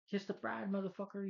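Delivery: high-pitched mock villain line; hammed-up villain. Reviewer mocking terrible film dialogue, hammed-up villain.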